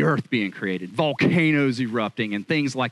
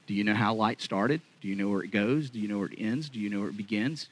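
softer, lower, probing questions; hushed questions; 4 semitones lower, 6 dB quieter than usual. Acted. God quietly pressing Job on where light begins. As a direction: lean in and ask it quietly.

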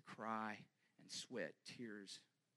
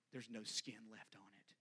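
grim whisper; 8 dB quieter than usual. Acted. Describing the scourging of Jesus.

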